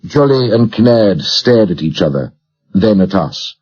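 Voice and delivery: plain reading voice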